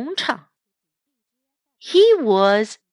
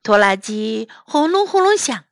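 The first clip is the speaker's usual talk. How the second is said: ordinary delivery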